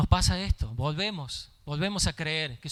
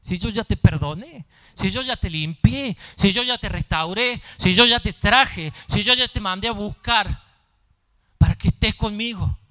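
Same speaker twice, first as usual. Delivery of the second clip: voz de Dios; 2 semitones higher, 8 dB louder than usual. Acted. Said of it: "Cuando Dios te dice".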